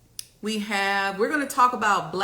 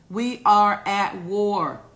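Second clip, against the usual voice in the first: louder and slow, every word weighted; slow emphatic declaration; 2 semitones higher, 8 dB louder, much slower than usual. Shown as a demonstration. Repeating it to the viewers as a slow, louder emphatic declaration. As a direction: hammer it home to the viewers, slowly. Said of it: "Do I need to get closer to the camera".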